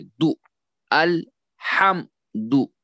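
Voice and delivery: "अल, हम, दु" one syllable at a time; syllables kept apart. Shown as a demonstration. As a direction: separate अल... हम... दु with short gaps. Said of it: "फिर इसी तरह अलग अलग करें". (अल, हम, दु अलग-अलग करके)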